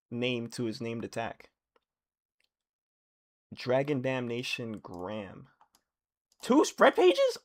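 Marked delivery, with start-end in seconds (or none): 6.4-7.4 much higher and louder in awe; excited. Real, not acted. Reader hit by two spread pages, high awe. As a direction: gasp at the double spread.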